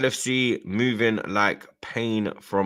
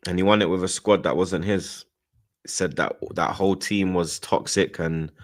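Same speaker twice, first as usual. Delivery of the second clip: ordinary talk, nothing marked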